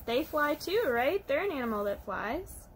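ordinary delivery

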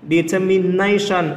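Determination slowed, with NA stressed; stress on fourth syllable. Shown as a demonstration. Teacher demonstrating the rule to students, slow Determination stressed on NA. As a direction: draw the word out and lean on NA in Determination. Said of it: "Âm số bốn".

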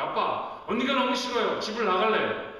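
put-on upset child. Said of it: "한 아이가 와서 만약 이렇게 말했다고".

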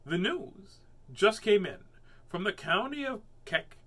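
newsy storybook voice; story reading. A narrator's reading. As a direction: announce it like a kids' story. Reading aloud to children.

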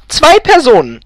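high, loud announcement; calling out. A narrator's reading. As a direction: call it out loudly on entering. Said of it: "rief Elfriede noch".

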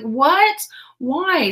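high, loud surprised disbelief. Retold or imitated. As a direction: gasp out the question in shock. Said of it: "And I was like".

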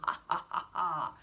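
loud, belly-held mocking laughter; giant's mocking laugh. Giant Kuparan laughing at Siegfried, loud mocking laugh. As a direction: laugh the hero to scorn. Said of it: "lachte Kuparan und hielt sich den Bauch".

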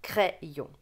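"crayon" with open è, crè-yon; Bocher method pronunciation. Shown as a demonstration. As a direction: textbook French "crayon", first vowel like è. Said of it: "Avec la prononciation de Beauchère".

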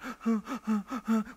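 high, hitching sob breaths; mimicked crying gulps for air; imitated sobbing gasps. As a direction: gasp in bursts like a bawling child. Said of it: "he starts to cry uncontrollably".

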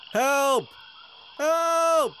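high, loud drawn-out scream for help; loud, high-pitched distress call; cry for help. A narrator's reading. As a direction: scream for rescue, pitch falling at the end. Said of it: "in de richting van het geschreeuw".

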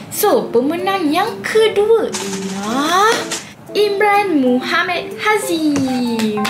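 high, slow winner reveal; hyped giveaway announcement. Real, not acted. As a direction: draw out the name like a host.

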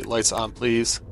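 normal talking voice

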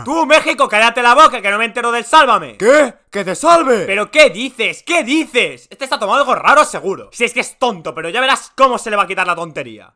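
usual speaking voice

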